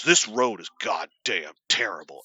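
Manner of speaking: low, slow, drawn-out complaint; grumpy dad impression. Dad on a beautiful road, mock disgust. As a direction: grouse like a disgusted old father.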